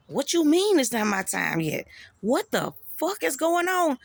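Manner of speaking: loud and high, demanding answers from God; retold outrage. Man reliving shouting at God, furious and loud. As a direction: yell the disbelief at God.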